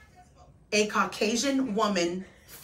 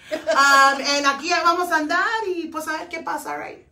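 Chona voice; 3 semitones higher, 4 dB louder than usual. Pretend. Host asks her to be Chona, Tex-Mex Chona greeting fans. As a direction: perform Chona hyping up the comadres. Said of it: "be Chona for a minute".

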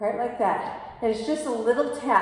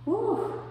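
high whoop; 9 semitones higher than usual. Real, not acted. Exertion whoop mid-twist.

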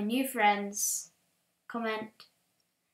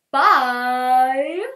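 loud goodbye; 14 dB louder than usual. Real, not acted. Outro goodbye.

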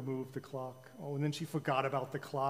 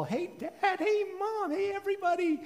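high, bright-voiced young girl; bubbly princess; 4 dB louder than usual. A narrator's reading. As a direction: chirp it out like a cheerful girl. Princess skips in, greeting.

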